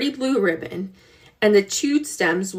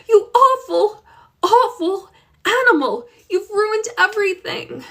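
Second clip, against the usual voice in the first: high, loud furious scolding; 11 semitones higher, 3 dB louder than usual. Acted. Girl yells at the goat that ruined the garden, furious. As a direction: rage at the goat for ruining everything. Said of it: "Josefina was furious".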